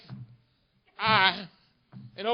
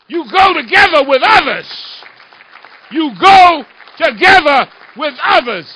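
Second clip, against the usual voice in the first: loud, raised, building preacher's cadence; fiery rally oratory; 6 semitones higher, 11 dB louder than usual. Real, not acted. Congressman urging the crowd to go together, full-force oratory. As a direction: drive each phrase home, louder as it builds.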